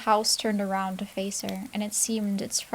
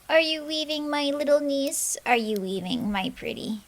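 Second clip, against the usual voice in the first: higher, louder, sugary witch voice; sweetest voice; 5 semitones higher, 3 dB louder than usual. Acted. Witch calls through the window, falsely sweet. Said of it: "her sweetest voice".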